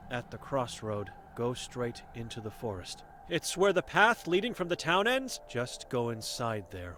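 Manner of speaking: a hint of a stern old seer, guarded; wary old Vera sizing up a stranger; blind old woman.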